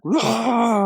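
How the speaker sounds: high, loud angry roar; mock roar. GBF raging at the players, hammed-up roar. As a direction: let out a villain's roar. Villain slams his hands down.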